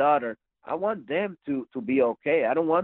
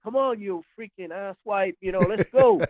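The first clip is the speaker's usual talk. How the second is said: higher-pitched mock challenge; goading; 8 semitones higher than usual. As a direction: act out squaring up for a fight, lightly. Retold son challenging to fight.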